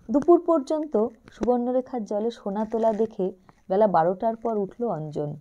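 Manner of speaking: usual speaking voice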